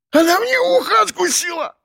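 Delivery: very high, strangled wail of pain; wheezing howl. A narrator's reading. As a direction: howl it hoarsely in shock and pain. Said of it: "Захрипел он, завывая".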